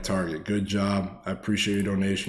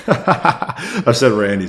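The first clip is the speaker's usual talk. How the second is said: laughing; 5 semitones higher, 11 dB louder, much slower than usual. Real, not acted. Laughs at own mistake.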